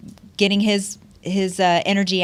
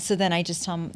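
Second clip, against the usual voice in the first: usual speaking voice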